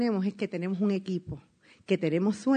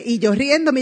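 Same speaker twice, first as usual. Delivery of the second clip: usual speaking voice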